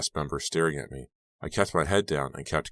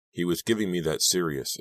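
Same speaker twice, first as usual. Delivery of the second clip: plain reading voice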